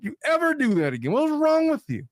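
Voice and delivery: high-pitched, acted outrage; exasperated mock telling-off; forceful scolding. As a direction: warn your partner like a fed-up husband. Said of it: "would he forcefully declare".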